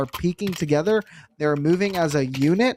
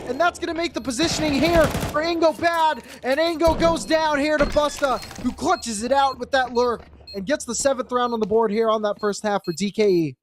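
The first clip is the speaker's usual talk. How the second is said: higher and rising hype call; excited esports caster; 7 semitones higher than usual. Acted. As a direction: build the call up to the clutch. Round climax call.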